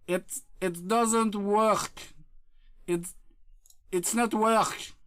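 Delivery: slow, halting, word-by-word English; laboured English. Acted. Streamer translating for chat, slow halting delivery. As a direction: pick out each English word slowly.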